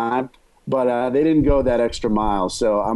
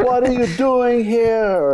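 put-on higher, slow, drawn-out foreign accent; stoned Borat voice; 10 semitones higher, slower than usual. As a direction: act surprised in a dazed accent. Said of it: "That sounded like a stoned Borat".

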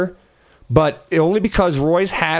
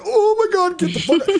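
mock panic. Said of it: "I was just like".

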